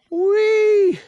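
a short, high, loud "wee"; delighted high whoop; slide whoop. A narrator's reading. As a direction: squeal it with glee on the way down. William slides down.

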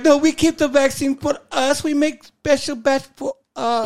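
put-on high Dana Carvey style German accent; hammy high German accent voice. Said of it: "I do Dana Carvey".

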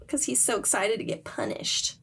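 ordinary delivery

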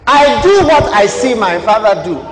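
loud, emphatic quoting of Jesus; a hint of Jesus speaking. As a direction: declare it to the Pharisees. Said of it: "Jesus told the Pharisees".

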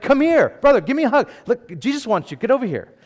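loud, high, eager welcome; mock enthusiasm. Turned crowd to the beggar, mock eager welcome. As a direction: beckon him over with hammed-up affection.

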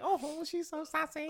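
high, slow, put-on cheerful voice; imitating housemate. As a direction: coo it like an amused outsider.

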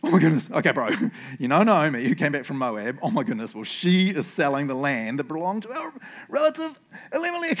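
giddy schoolboy, starting loud; anxious excitement. Shown as a demonstration. Boaz nervously tells the redeemer about Naomi's land, anxious excitement. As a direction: gush nervously as if bursting with news. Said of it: "this is my, like, schoolboy impressions".

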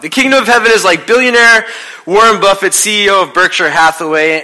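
a preacher's big declaration; emphatic restatement. Shown as a demonstration. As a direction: announce it to the whole church. Repeats the line with emphasis.

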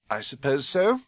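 cold, clipped reply; stiff. Acted. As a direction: answer stiffly. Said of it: "said Missus Dursley stiffly".

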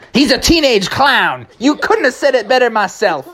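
very high cartoon crook; high-pitched outlaw glee; Criminal Kevin. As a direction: crow it like a silly jailbird. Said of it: "Criminal Kevin".

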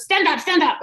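high-pitched barked order; mock an interviewer yelling commands; imitated screaming boss. Owner ordering the applicant to her feet, mock yelling. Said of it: "Like, screaming at me".

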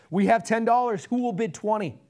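loud, high bidding call; auctioneer. Acted. Auctioneer taking the gardener's offer, calling out loudly. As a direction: call for the next bid. Auctioneer calls for higher bids.

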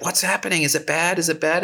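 high, panicky questions; mock-panicked high voice asking if it's bad. Drunk castmate panicking, quoted.

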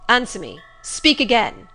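loud, commanding order; forceful master's command; imperious. A narrator's reading. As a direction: demand it imperiously, raising your voice. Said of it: "imperiously and aloud".

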